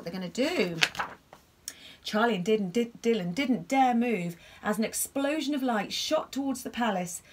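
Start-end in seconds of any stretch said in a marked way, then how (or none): none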